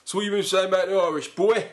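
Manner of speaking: loud, high, quick challenge; hammed-up bruiser picking a fight; mock hard man. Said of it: "Please don't talk like that".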